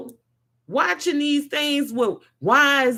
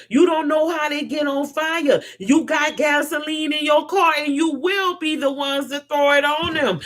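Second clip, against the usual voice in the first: ordinary talk, nothing marked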